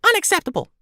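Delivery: very high, squeaky little creature voice; squeaky helium voice. Acted. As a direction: talk like you sucked a helium balloon. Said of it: "in his squeaky helium balloon voice".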